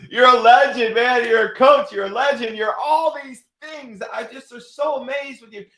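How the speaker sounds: high, loud gushing praise with a laugh; excited praise. Retold or imitated. Son gushing praise to his father, excited. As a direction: gush over the legend.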